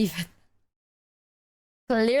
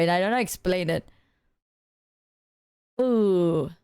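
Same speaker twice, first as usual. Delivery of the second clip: normal talking voice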